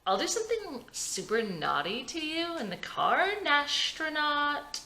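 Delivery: tipsy wife teasing her husband, slow and flirty; tipsy flirty purr. Acted. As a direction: stretch the pet name out slowly. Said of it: "Vicky is tipsy now".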